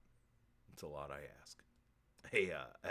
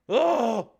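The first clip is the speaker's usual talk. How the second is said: loud, high yelp; pained mock cry; 5 semitones higher, 13 dB louder than usual. Seance spirit is cut off suddenly, loud high yelp.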